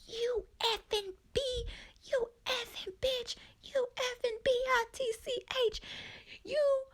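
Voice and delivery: high falsetto mock tirade; screeching Barb. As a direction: shriek it like a furious mom. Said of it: "She's like".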